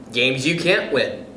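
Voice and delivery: ordinary delivery, nothing marked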